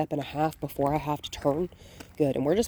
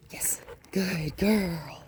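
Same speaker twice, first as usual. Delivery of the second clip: whispered praise; much slower than usual. Real, not acted. Quiet praise to the dog.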